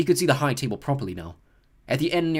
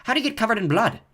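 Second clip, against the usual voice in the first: keen interest; 10 semitones higher, 5 dB louder than usual. A narrator's reading. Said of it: "asked Seamus with great interest".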